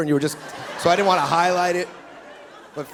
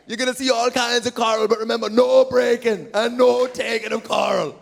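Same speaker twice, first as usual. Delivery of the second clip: Jamaican tour guide; 5 semitones higher than usual. Retold or imitated. Comic imitating the glass bottom boat guide in a Jamaican accent. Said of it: "he goes".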